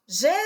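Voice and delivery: JESUS with the last syllable held long; calling-out demo. Shown as a demonstration. Teacher showing how to find the stressed syllable, drawn-out call on JeSUS. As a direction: stretch the SUS of "Jesus" like calling someone far away. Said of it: "como se você estivesse gritando para alguém bem longe".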